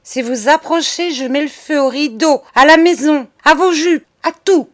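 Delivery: high, building to a loud shout; menace criée. Acted. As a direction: escalate the threat, punching each item. Charles threatens to set fire. (menace qui monte jusqu'au cri)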